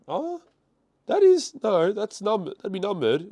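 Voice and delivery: sudden high surprised "oh". Real, not acted. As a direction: caught off guard by the card. Spots a numbered card.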